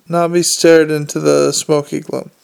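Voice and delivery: usual speaking voice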